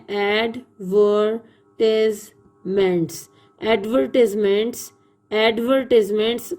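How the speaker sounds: ایڈورٹیزمنٹس said ad-VER-tiz-ments, short i, not -tize-; teacher drilling ایڈورٹیزمنٹس with short i, not ad-ver-TIZE-ments; correct pronunciation. Shown as a demonstration. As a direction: say ایڈورٹیزمنٹس slowly with a short i in the middle. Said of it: "اس کی pronunciation ہوگی ایڈورٹیزمنٹس".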